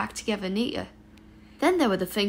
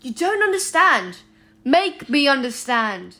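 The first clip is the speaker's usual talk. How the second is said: insistent; 4 semitones higher, 8 dB louder, slower than usual. A narrator's reading. Insistent plea.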